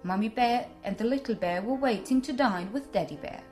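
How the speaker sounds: ordinary delivery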